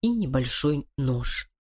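ordinary delivery, nothing marked

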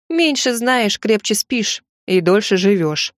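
plain reading voice

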